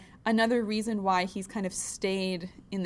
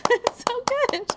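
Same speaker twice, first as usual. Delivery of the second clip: excited squeal; 6 dB louder than usual. Real, not acted. Squealing delight at show intro.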